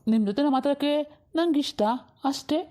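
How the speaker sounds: coy, rising sweet talk going high; flirty. Acted. Roadside flirt woos the waiting woman, coy and going high. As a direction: sweet-talk her, voice climbing up.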